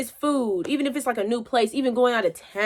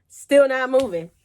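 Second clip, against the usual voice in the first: ordinary delivery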